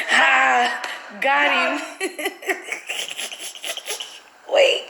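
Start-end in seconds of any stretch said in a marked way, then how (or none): 1.9-4.1 unvoiced wheezing laugh; breathy laughter. Real, not acted. Prank revealed, laughing.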